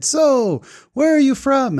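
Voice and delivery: very high, eager question; Abdulia. Retold or imitated. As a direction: chirp it like an over-friendly cab driver. Driver's eager question, acted out.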